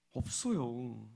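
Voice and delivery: ordinary talk, nothing marked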